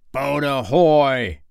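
long, high, drawn-out sea call; slow pirate captain's holler; Hook's ringing hail. A narrator's reading. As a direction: hail a boat across a lagoon. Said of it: "rang over the lagoon in Hook's voice".

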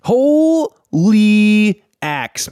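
high, very drawn-out exclamation; awestruck. Acted. Player's character looks at the axe, slow high awe. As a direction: stretch each word slowly, high with amazement.

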